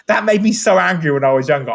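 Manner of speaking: ordinary talk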